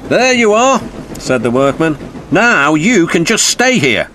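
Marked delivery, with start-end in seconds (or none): 0.1-0.8 high, louder, hearty delivery; hint of a brisk workman; lightly voiced workman. Workman settles the barge. 2.3-4.1 high, louder, hearty delivery; hint of a brisk workman; lightly voiced workman. Workman addresses the barge.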